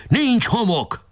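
high call from above; shouted down. A narrator's reading. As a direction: call down from the fort. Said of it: "lekiáltott".

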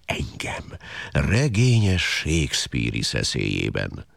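brooding, self-loathing hyena monologue; villainous hyena. Acted. Hyena confesses it was created as nature's villain, darkly theatrical. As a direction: play the despised villain, mostly low and theatrical.

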